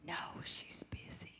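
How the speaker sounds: put-on slow hushed child's whisper. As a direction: keep it secret, barely voiced. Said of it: "he whispered when he answered".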